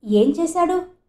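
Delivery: husband pretending not to know; feigned surprise. A narrator's reading. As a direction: play dumb, as if shocked. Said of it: "ఏం తెలియని వాడిలా ఆశ్చర్యంగా అడిగాడు".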